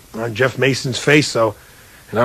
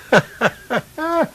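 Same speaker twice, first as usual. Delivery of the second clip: bright high burst of laughter; 3 dB quieter than usual. Real, not acted. Speaker laughing with the audience at the punchline. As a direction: laugh at your own punchline. Laughing at his own story.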